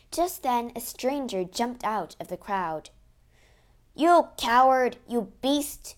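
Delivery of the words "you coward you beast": loud, accusing outburst; shouted insults at a cruel man; angry shout. A narrator's reading. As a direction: yell it at the brute. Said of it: "he shouted".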